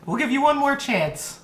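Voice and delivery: fairy. Acted. Fairies granting another chance, high-pitched. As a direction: pipe up high as a fairy judge. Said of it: "the, the fairies go".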